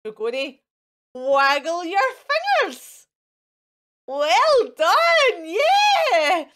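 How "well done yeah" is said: high, loud cheering well done; bright teacher's cheer; excited praise. Real, not acted.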